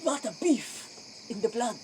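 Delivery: ordinary talk, nothing marked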